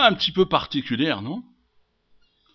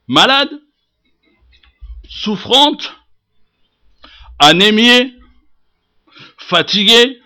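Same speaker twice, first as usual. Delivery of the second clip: loud, high, deliberate declamation; forceful preaching; 9 semitones higher, 6 dB louder, slower than usual. Acted. Preacher listing the sick woman's plight, forceful. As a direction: pile up her troubles one by one with rising force.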